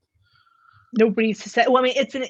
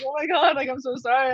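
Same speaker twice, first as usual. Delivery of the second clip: flustered apology; 6 semitones higher than usual. Retold or imitated. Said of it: "I'm like".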